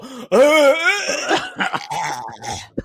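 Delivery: high cackling witch laugh; witch cackle. Retold or imitated. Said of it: "a witch casting a spell".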